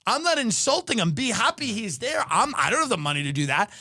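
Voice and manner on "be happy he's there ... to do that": normal talking voice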